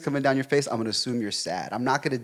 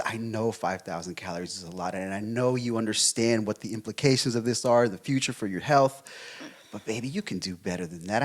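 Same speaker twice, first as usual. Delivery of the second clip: softer and lower, coaxing his partner kindly; gentle, caring; 3 semitones lower, 3 dB quieter than usual. Shown as a demonstration. Boyfriend gently coaxing girlfriend about ice cream, soft and caring. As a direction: ease her into the truth gently, like soothing a child. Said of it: "it's the way in which I say it".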